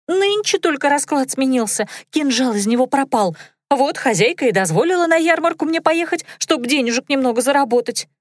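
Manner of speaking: high, fast squeaky maid; squeaky girl voice. A narrator's reading. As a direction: chatter in a thin squeak. Dunka squeaking.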